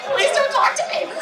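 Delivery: high, lightly voiced bored crowd member; mock annoyed audience. Comic voices phone-staring audience, high mock plea. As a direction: squeak it like someone hiding in their phone. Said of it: "They're like".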